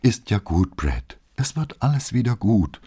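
tender comfort for wounded Brett; soothing. Acted. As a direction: hush her gently. Soothing injured friend.

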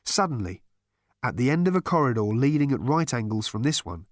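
usual speaking voice

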